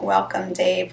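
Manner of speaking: normal talking voice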